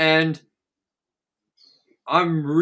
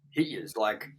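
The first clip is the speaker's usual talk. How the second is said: ordinary talk, nothing marked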